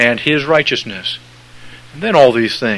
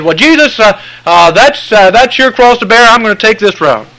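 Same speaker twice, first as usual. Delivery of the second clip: mock stubborn follower; 9 semitones higher, 6 dB louder than usual. Mocking folks who refuse Jesus.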